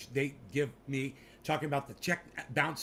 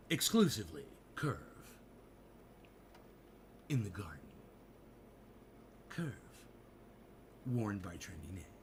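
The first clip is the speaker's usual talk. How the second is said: soft, low ad read with long pauses; mock cologne ad; 5 semitones lower, 7 dB quieter than usual. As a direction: intone it like a smooth fragrance commercial.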